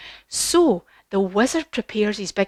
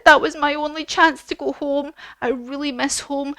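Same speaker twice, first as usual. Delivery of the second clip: mild crying; 6 semitones higher than usual. Acted. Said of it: "She starts crying".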